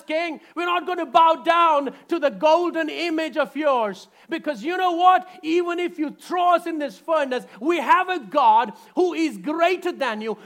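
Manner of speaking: loud, high, fierce defiance; defiant shout. Retold or imitated. Three young men defying the king, shouting boldly. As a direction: shout down a tyrant. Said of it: "They said it in a very, very polite way".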